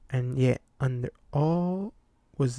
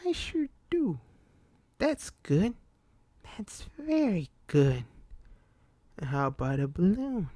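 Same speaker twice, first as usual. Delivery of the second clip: creepy clown voice; 6 semitones higher than usual. A narrator's reading. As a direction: tease him sweetly, pitched up. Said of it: "The clown laughed".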